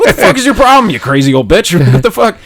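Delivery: high, loud yelling with a laugh breaking in; telling off the old lady in the store; retold yelling. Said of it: "I start yelling at this old lady".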